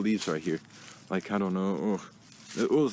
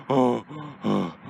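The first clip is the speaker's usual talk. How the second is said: usual speaking voice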